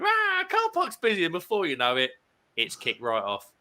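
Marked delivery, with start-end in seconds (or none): 0.0-1.2 much higher mocking sing-song; teasing echo pitched way up; mocking jeer. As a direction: taunt it like a playground jeer. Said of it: "Brad Evans starts going".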